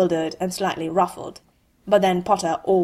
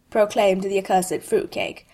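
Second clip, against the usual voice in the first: ordinary delivery, nothing marked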